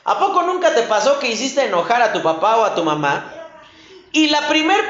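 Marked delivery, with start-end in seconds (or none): none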